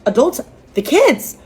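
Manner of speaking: rising, disbelieving questions; incredulous echo. Retold or imitated. Daughter echoing the girlfriend's insult, incredulous. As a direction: throw each word back in disbelief.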